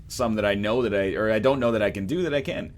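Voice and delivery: usual speaking voice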